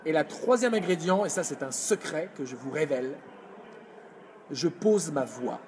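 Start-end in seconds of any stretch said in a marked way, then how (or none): none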